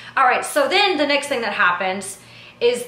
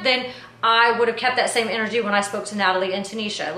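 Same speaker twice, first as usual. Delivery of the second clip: usual speaking voice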